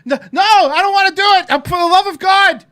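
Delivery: high, loud, hammed-up panicked pleading; mock panic. Host mocks the wife panicking when put on the spot. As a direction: mimic her frantic refusal. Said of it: "And she's panicking".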